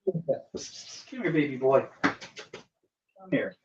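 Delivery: soft, hushed coaxing of the cat. Real, not acted. Owner coaxing her scared cat to come, soft and hushed. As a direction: lure him in gently, almost whispering.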